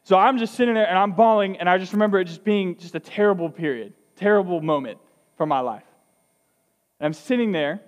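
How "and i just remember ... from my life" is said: ordinary talk, nothing marked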